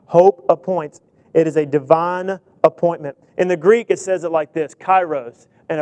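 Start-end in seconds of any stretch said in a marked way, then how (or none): 0.0-1.0 "Hope appoints" louder, hitting Hope; stress on Hope. Shown as a demonstration. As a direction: punch "Hope" then let "appoints" fall. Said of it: "I want you to watch this".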